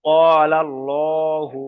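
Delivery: ক্বালাল্লাহু drawn out slowly, with a full, dark "llo"; full heavy L. Shown as a demonstration. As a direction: say ক্বালাল্লাহু with a heavy, rounded "llo", vowels held long. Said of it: "অর্থাৎ ল করে পড়তে হবে".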